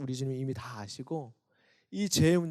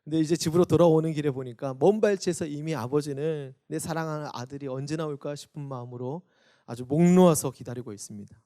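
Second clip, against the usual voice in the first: usual speaking voice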